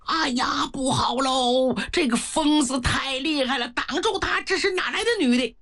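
frantic soldiers' shout; panicked guards shouting. Acted. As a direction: cry out in panic as a madwoman cuts through the ranks. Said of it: "禁卫军喊".

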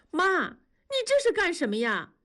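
high, alarmed cry at her mother-in-law. A narrator's reading. Wenli to her mother-in-law, alarmed high cry. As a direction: call out high in disbelief. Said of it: "喊着".

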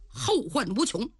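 plain reading voice

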